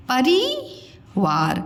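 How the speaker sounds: परिवार slowly as pa-ree-waar; गलत लंबी ई. Shown as a demonstration. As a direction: stretch रि in परिवार. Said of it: "परिवार नहीं बोलते हैं हम". (परिवार को परीवार खींचकर)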